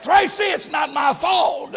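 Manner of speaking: hollering to his wife, retold; mock holler across the house. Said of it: "And I hollered".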